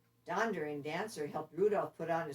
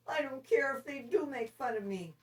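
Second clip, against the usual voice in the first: put-on a higher, determined young reindeer; Rudolph voice; 7 semitones higher than usual. Rudolph resolves to help despite teasing, higher and earnest. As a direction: brave little voice. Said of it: "said Rudolph suddenly".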